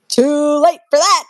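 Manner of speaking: playful taunt. Real, not acted.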